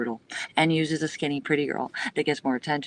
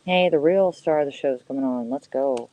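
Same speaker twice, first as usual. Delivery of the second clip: usual speaking voice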